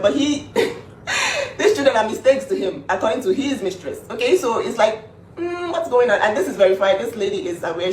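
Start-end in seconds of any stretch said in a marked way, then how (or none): 1.1-1.5 a short high burst of disbelieving laughter; scoffing high laugh; sharp high laugh. Real, not acted. Laughs at his hypocrisy. 5.4-6.2 high, sarcastic what's going on; higher, faux-confused question; mock puzzled. Real, not acted. Mock puzzled at his claims.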